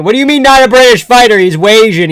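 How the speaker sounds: high, loud incredulous retort; indignant disbelief. Real, not acted. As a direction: throw it back in outraged disbelief. Throws the objection back indignantly.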